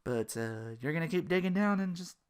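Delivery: ordinary delivery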